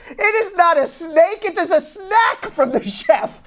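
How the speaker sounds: put-on high, slow, emphatic broken-English accent; insulted foreign owner. Insulted owner correcting diners about the chef's snack, in broken English. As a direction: correct them in an indignant, drawn-out foreign accent. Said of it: "He's very insulted".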